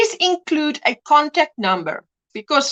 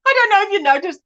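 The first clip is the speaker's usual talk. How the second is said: laughing; 7 semitones higher, much faster than usual. Real, not acted. Laughs as the lamp switches on.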